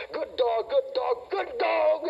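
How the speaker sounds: rising, high coaxing praise; sweet-talking a dog. Shown as a demonstration. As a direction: coo at the dog in a sing-song. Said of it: "he's listening to the tone of my voice".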